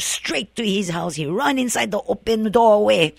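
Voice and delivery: plain reading voice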